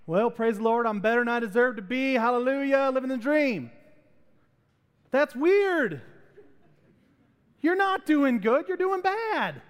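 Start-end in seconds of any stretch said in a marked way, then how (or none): 0.1-3.7 high-pitched, put-on church cheer; mock chipper. Churchgoer answering news of lost job and cancer, falsely chipper. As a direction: gush with fake praise, pitch climbing. Acted fake-happy reply to bad news.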